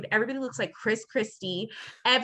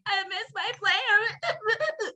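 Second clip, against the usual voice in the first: slow, high mock crying with a laugh; mock sob; 10 semitones higher, much slower than usual. Retelling crying to the agent, hammed-up mock sob. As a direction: whine it through fake tears. Her sobbing self retold.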